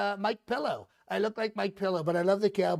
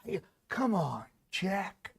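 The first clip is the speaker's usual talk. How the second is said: Joe Biden; 4 dB quieter, slower than usual. Acted. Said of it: "Joe Biden".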